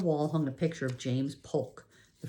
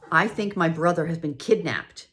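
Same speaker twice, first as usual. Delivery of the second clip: loud, urgent blurt; 4 semitones higher, 9 dB louder than usual. A narrator's reading. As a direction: blurt out the worst. Girl reporting kidnapping urgently.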